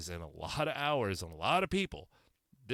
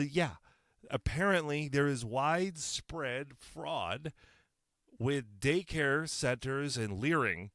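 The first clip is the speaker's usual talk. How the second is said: usual speaking voice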